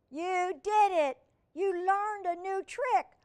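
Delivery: high, loud, drawn-out cheer; delighted praise. Acted. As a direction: celebrate the puppy's success. Girl celebrates puppy's trick.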